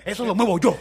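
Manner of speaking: hammed-up stagehand; jealous stagehand. Imitating a jealous set worker.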